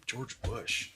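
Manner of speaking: muttered Bush impression; George Bush impression. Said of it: "Do your George Bush impersonation".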